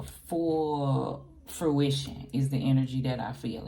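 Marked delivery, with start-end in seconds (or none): 0.0-2.3 slow, stretched delivery with an odd voice; drawn out. Real, not acted. Tarot reader promising Geminis justice, slow and stretched. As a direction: lean into full fruition slowly. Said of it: "I don't know why I'm saying it like that".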